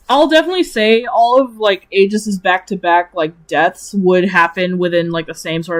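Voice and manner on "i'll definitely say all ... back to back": ordinary delivery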